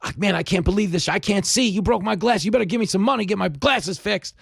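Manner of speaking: high-pitched, indignant hustler rant; hustler's mock screaming. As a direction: act out a scammer wailing over broken glasses. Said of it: "and starts screaming again".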